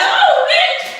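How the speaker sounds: shrieking high and loud with a laugh; excited shriek. Acted. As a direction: let it fly, squealing with delight. Excited shout while dancing.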